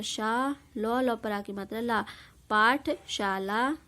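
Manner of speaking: slow, drawn-out पाठशाला, each syllable stretched; slow blending. Shown as a demonstration. Teacher slowly blending पाठशाला for pupils.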